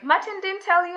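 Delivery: high-pitched, retold disbelief; surprised question. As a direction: ask it as a surprised boss, pitched well up. Boss's surprised question, retold again.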